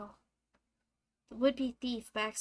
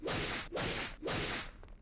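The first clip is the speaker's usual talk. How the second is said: sharp gasp. Acted. Gasp, unvoiced.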